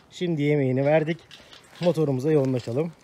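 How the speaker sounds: ordinary talk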